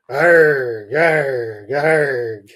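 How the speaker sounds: three loud, falling "arg" barks; excited barking cry. Acted. Richard races out to the truck, loud barked args.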